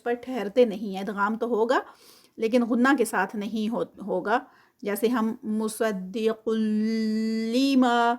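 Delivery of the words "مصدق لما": مصدق لما very slow, لام merged, no nasal hold; idgham without ghunna. Shown as a demonstration. Teacher showing idgham without ghunna. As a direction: recite لما with no ghunna, not pausing on قل. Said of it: "قل لما اتنا جتنا کہ اس کو آپ آرام سے".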